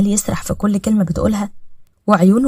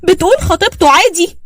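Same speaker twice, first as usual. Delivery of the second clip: much higher and louder, near shrieking; the wife screams after learning he is engaged, hysterical; hysterical outburst; 11 semitones higher, 3 dB louder than usual. Acted. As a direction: break down screaming in betrayal. Throws things, hysterical outburst.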